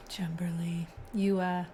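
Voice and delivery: hushed whisper to someone nearby. Real, not acted. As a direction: whisper it so the stream barely hears. Aside to someone off camera.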